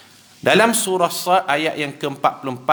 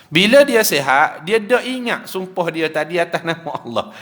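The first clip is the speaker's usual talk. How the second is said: ordinary talk, nothing marked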